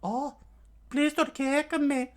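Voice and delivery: put-on high girlish falsetto; put-on damsel begging; Nami's voice. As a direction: plead sweetly in falsetto. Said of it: "with Nami's voice".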